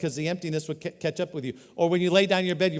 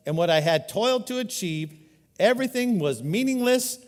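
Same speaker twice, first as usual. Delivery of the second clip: dramatic scripture reading; 3 semitones higher, 5 dB louder than usual. Acted. Preacher reads Solomon's words.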